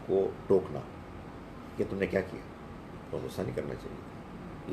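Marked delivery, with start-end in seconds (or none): 3.1-4.7 quiet self-reproach. Retold or imitated. Scolding oneself afterwards.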